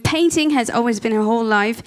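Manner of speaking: ordinary delivery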